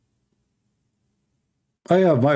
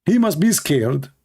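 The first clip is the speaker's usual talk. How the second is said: ordinary delivery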